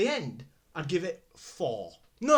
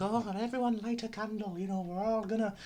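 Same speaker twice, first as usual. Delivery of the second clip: imitated Scottish accent, pitched up; Scottish woman; 4 semitones higher than usual. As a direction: lead the group as a high-voiced Scottish woman. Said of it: "this Scottish woman".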